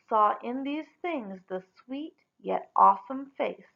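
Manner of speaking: plain reading voice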